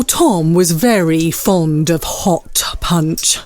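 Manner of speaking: ordinary talk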